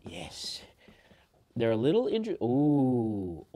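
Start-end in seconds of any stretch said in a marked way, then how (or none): 0.1-0.6 whispered to self; whispered yes. Real, not acted. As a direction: breathe out a quiet yes. Quiet celebration before run.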